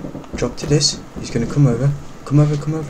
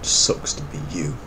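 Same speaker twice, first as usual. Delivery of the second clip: unvoiced gloating whisper; muttered sneer under the breath; whispered taunt; 10 semitones lower, 11 dB quieter than usual. Real, not acted. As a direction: whisper it smugly at the rival.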